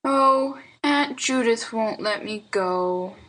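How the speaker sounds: put-on slow, let-down little boy; despondent child. A narrator's reading. Boy expects his aunt to refuse, despondent. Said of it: "said Lionel Hezekiah despondingly".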